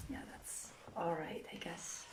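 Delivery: under the breath, to herself; a hushed whisper shrugging off a slip; whispered aside. Real, not acted. Muttered aside after a slip.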